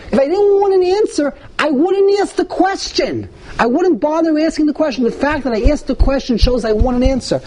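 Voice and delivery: high, pleading voice of a defiant son; insistent teen pushing his father; Rasha child's plea. Acted. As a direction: play the rebellious son demanding an answer.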